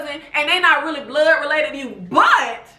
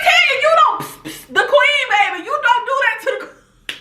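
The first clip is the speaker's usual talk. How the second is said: scolding Tanya; 9 semitones higher than usual. Retold or imitated. Fan scolding Tanya at the opera, mock-shocked high fussing. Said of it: "I'm like".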